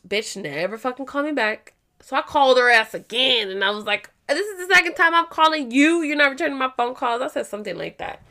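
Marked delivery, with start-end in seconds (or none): 4.3-7.2 irritated voicemail. Retold or imitated. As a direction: scold her for not calling back. Second angry voicemail to counselor.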